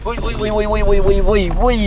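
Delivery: high, thrilled chant urging the jeep on; excited cheering. Real, not acted. Jeep climbing rough off-road. (ആവേശത്തോടെ ആർപ്പുവിളി)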